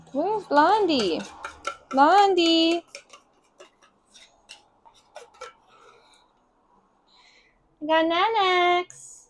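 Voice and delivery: high, slow coaxing voice for a pet; sing-song pet voice, stretched out. Real, not acted. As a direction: coo to the kitten to lure her out. Baby talk to the cats.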